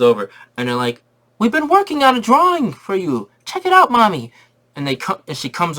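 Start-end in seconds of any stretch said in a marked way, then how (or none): 1.4-4.3 put-on squeaky high little kids calling Mommy; kid voices. Kids begging their mom to come see. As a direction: chirp it up high like excited little boys.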